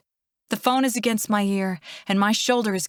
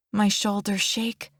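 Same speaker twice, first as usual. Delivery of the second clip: ordinary delivery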